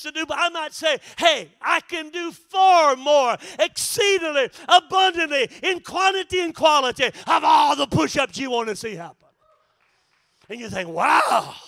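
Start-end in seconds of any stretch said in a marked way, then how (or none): 1.2-9.3 cocky boast. Retold or imitated. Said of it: "getting a little cocky in my abilities". 10.9-11.5 amazed wow. Retold or imitated. Listener amazed at boast.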